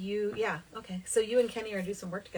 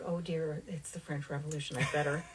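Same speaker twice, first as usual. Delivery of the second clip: lower, softer aside to oneself; retold dismay; 6 semitones lower, 7 dB quieter than usual. Recalling the French Revolution job, mock worry under the breath. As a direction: sink into a hushed, mildly dismayed mumble. Retelling own worried thought.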